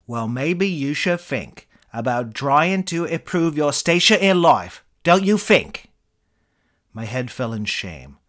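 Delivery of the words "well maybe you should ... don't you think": put-on British accent, higher and much louder, scornful; scornful Eric Idle. British accent, the celebrity lecturing the room service guy, scornful. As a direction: sneer it in a Cambridge-tinged British voice. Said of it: "your British accent".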